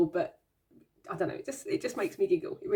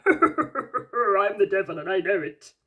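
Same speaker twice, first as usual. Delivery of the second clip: slow, louder, put-on voice for a proud little devil; cute devil voice; 6 dB louder, slower than usual. Voicing the devil card boasting, slow put-on voice. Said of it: "He's like".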